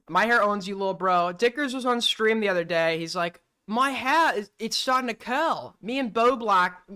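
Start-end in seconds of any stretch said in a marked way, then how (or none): 3.7-6.8 imitating an Australian, pitched up; a put-on Australian voice quoting a friend; lightly mocking Australian accent, a bit higher. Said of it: "I'm doing an Australian accent".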